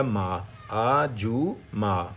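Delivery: very slow, stretched syllables; syllable-by-syllable demo. Shown as a demonstration. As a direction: draw out آ, جو, ما for learners. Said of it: "تو کیا بن جائے گا". (ہر جز لمبا کر کے آہستہ بولیں)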